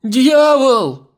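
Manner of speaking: high, very loud terrified scream; yelling. A narrator's reading. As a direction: scream the alarm at full voice. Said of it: "Нюша орала за двоих". (истошный крик ужаса)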